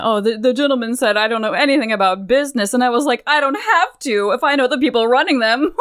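hammed-up braggart ending in a showy laugh; Mamajaru. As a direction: brag like an insufferable rich bore. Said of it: "Mamajaru's in the middle of".

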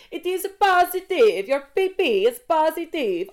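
high, loud, drawn-out mock Italian; shouted Italian accent. Pharmacist announcing results to the whole shop, shouted in mock Italian accent. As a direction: proclaim it slowly in a hammed-up Italian accent. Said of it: "stop shouting out my personal information".

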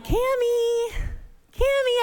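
put-on high, rising calls for a child; calling out. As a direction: call her across the playground. Mom searching for lost child.

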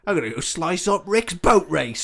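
laughing Cockney threat. Retold or imitated. Continuing the Cockney thug.

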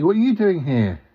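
normal talking voice

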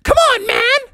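put-on very high squeaky little-kid protest; indignant Junior. Junior objecting to being called baby, squeaky indignation. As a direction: squeal it fast, outraged. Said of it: "And Junior was like".

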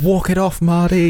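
high, loud mock coach yell; shouted coach voice. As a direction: bark it like a coach. Mimicking coach shouting at injured player.

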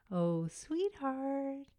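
put-on high drawn-out baby talk; silly sing-songy voice. Shown as a demonstration. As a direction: coo it slowly like you're talking to a baby. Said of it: "silly sing-songy voice".

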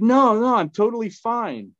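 high, breezy it's-nothing reassurance; insisting fine. Retold or imitated. Telling the panicking dog owner he's fine, high and breezy. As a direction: wave off the worry. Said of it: "And I said".

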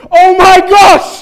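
hammed-up panicked Mancunian yell, very high; acted panic. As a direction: shriek it slowly in mock panic, Mancunian accent. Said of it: "that's the panic".

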